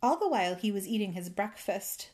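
usual speaking voice

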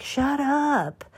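mock-irritated hush; annoyed shut up. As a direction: grumble it at the neighbors' party noise. Annoyed at neighbor's party noise.

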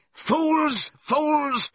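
high, drawn-out cry of outrage; furious shout. A narrator's reading. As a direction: yell it at the crowd, very high and loud. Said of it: "shouted Benjamin".

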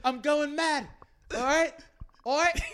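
mock British accent. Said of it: "You the work of your accent".

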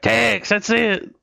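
high slow excited realization. Retold or imitated. Imitating a character's realization.

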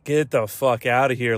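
ordinary talk, nothing marked

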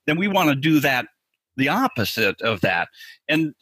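ordinary talk, nothing marked

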